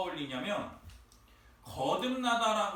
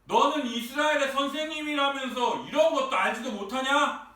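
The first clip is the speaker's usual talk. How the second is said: loud, raised voice in an acted telling-off; angry scolding; 5 semitones higher, 5 dB louder than usual. Preacher plays Jesus scolding Israel's teacher, angry and loud. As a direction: scold hard, as if out of patience. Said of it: "예수님이 화가 나셔서 이러는".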